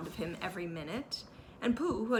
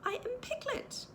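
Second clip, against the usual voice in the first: much higher and slow little Piglet; squeaky insistence; 3 dB quieter, slower than usual. A narrator's reading. Piglet telling Kanga who he is, high and slow.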